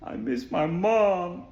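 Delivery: slow, drawn-out mock sob; put-on tearful whine, dragged out slowly; imitated crying child. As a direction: imitate a child wailing for mom, stretched out. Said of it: "starts crying. She's like".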